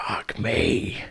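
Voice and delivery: whispered swearing under the breath; exasperated whisper. Acted. Exasperated companion swearing while the party sneaks, whispered. Exasperated aside during stealth scene.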